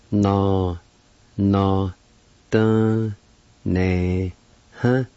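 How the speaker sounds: "Na Na, da, ne, huh?" broken into drawn-out syllables. Shown as a demonstration. Teacher pronouncing the word performance, "Na Na, da, ne, huh?" syllable by syllable. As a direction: pause after each syllable of "Na Na, da, ne, huh?". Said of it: "is pronounced like this".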